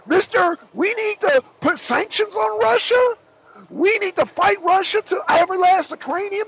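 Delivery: a high, lightly voiced Macron; mock Macron. Macron pushing sanctions, lightly mocked in a high voice. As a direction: lightly parody Macron's war talk, pitched high. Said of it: "I'm just reenacting the phone call".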